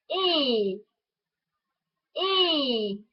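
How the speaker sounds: E held long and clear, dropping; long E vowel. Shown as a demonstration. Teacher models the vowel E slowly. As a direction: draw out E as in see. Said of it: "The first, the monophthong".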